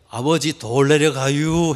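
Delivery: very slow, drawn-out call; comically slow warning to a father; slow Chungcheong speech. Retold or imitated. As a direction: stretch the warning out unhurriedly, Chungcheong style. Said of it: "충청도 사람들은 느리다는 표현".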